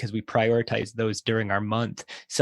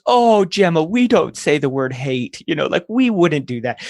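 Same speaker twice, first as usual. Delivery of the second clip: put-on chiding older sister; 6 semitones higher, 6 dB louder than usual. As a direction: imitate a big sister gently telling off the little one. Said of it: "and she goes".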